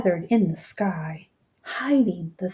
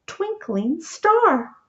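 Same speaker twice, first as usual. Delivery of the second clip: ordinary delivery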